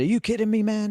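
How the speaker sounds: high-pitched mock American; imitation American, pitched well up; American accent. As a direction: put on an incredulous American accent. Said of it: "that's my American accent".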